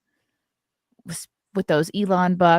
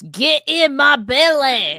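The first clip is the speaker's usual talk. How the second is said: slow, louder Scottish "Get in my belly!" with "my" stretched; Scottish Fat Bastard; 6 semitones higher, 7 dB louder, much slower than usual. Retold or imitated. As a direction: growl "Get in my belly!" in a thick Scottish accent. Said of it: "Scottish phrases I know are from Austin Powers".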